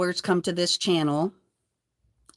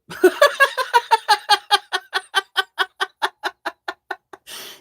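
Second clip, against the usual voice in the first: long shrieking laugh, much higher; long high cackle; 14 semitones higher, 6 dB louder than usual. Real, not acted. Mocking the man who threatened her, high cackle. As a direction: cackle in triumph. Laughs after quoting him.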